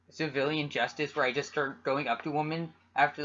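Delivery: ordinary talk, nothing marked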